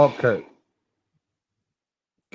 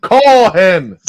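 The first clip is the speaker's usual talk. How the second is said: shouting; 9 semitones higher, 11 dB louder than usual. Real, not acted. Heated demand during late-night argument.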